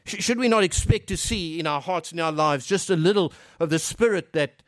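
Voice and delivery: usual speaking voice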